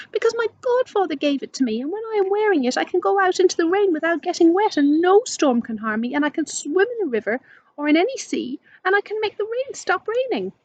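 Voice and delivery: high, quick, breathless rush of words; eager explanation. Acted. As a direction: tumble it out proudly to the princess. Laura eagerly explains her necklace.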